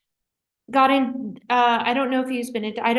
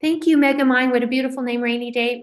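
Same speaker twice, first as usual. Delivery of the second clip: usual speaking voice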